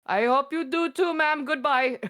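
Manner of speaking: high, sugary call-center politeness; scam caller voice. Retold or imitated. Retelling the scam caller's sweet reply in a high voice. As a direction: lightly mimic an overly polite scammer. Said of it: "I was like".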